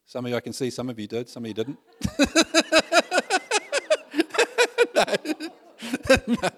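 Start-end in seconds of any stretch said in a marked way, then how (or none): 2.0-4.9 high laugh. Real, not acted. Congregation moment, laughter. 4.9-6.5 laughing. Real, not acted. Laughing at own joke.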